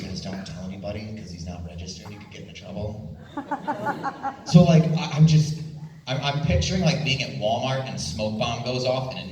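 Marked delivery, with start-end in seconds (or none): 0.0-3.2 low, hushed plea for secrecy; mock confidential warning. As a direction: let them in on it quietly.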